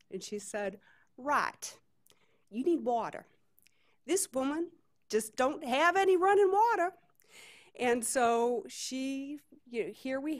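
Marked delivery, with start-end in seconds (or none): 1.2-6.9 put-on Southern voice, climbing high at the end; amateur Southern accent, rising to an indignant high finish; [name]'s Southern accent. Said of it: "try to do a Southern accent".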